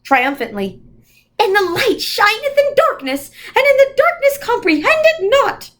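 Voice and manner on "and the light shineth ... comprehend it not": much higher, ringing recitation; triumphant quotation. A narrator's reading. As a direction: proclaim the verse triumphantly. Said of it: "shone out in the triumphant".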